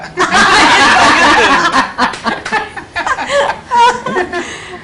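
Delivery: real, prolonged laughing fit; long burst of laughter. Real, not acted. Cracking up over the pulihora and bagara joke, laughing.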